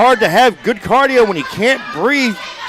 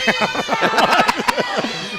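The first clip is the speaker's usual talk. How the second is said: hearty laugh; 2 semitones higher, 7 dB quieter than usual. Real, not acted. Laughs at own impression.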